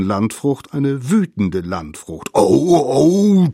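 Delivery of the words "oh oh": loud, high howl of pain; snorting outrage. A narrator's reading. Bitten mammoth wakes up, howling in outrage. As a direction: bellow out the hurt. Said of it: "schnaubte das Mammut".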